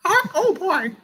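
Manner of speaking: piercing squeak, very high; squeaky cartoon mouse voice; Mickey Mouse impression. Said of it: "you have a good Mickey Mouse impression?".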